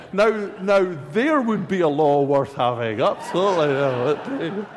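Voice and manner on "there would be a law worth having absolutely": laughing. Real, not acted. Joking about a welcome law.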